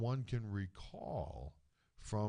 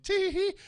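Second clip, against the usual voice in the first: high, louder tee hee giggle; grandma's giggle; 10 dB louder than usual. Retold or imitated. Imitating Grammy's reaction at a family gathering, high giggle. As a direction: giggle it like a tickled old lady. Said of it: "Grammy would have ... went".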